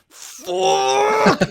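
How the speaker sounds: very high, loud drawn-out yell; freak-out scream. Retold or imitated. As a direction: let the swear burst out as an acted scream. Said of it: "Starts freaking out, crying, and I just catch myself being like".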